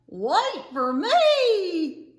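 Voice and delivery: long drawn-out holler; calling out. Acted. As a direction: shout after someone leaving you behind. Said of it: "Bo called".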